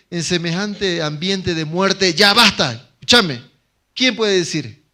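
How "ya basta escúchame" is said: firm command. Acted. Calm pastor stops the crying.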